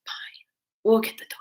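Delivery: grandma's reluctant yes, whispered; whispered quote. Retold or imitated. Grandma giving in about the dog, whispered.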